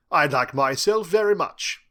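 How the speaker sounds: slow, loud, self-satisfied British voice; British accent. A narrator's reading. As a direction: preen a little in suave British English. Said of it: "his flawless British accent".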